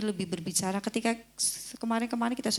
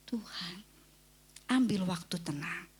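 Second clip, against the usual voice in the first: ordinary talk, nothing marked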